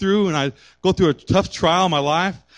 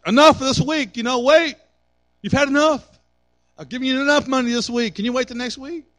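exasperated parent; 9 semitones higher than usual. Retold or imitated. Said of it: "And we're like".

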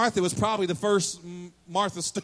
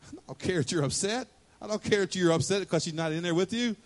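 ordinary talk, nothing marked

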